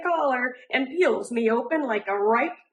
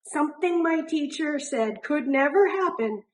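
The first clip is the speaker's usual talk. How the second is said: ordinary delivery, nothing marked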